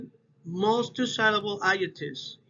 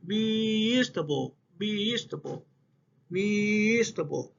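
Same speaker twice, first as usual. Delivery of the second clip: "vegetable" with its opening syllable held long each time; stress on first syllable. Shown as a demonstration. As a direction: lean hard and long on the start of "vegetable". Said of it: "in the third part of the word".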